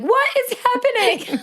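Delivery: high, mock-bewildered question; imitating a baffled friend in the kitchen; baffled surprise. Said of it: "Steph's in the sink being like".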